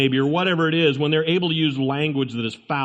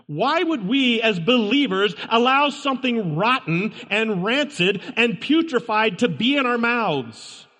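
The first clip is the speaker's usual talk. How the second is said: earnest pulpit voice, higher, pressing each point; sermon emphasis, hammering "not"; preaching; 7 semitones higher than usual. Acted. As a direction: drive the warning home to the kids. Preaching against vulgar words.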